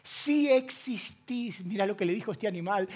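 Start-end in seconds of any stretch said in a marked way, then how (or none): 0.0-1.6 slow doubting plea. Retold or imitated. Desperate doubting prayer.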